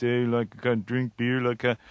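a light, hammed-up Canadian hoser accent; lightly mocking Canadian accent. Shown as a demonstration. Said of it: "talk like this".

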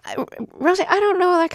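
amazed disbelief. Real, not acted. Host to guest, floored by her story, high amazed. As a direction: voice jumps up in real astonishment.

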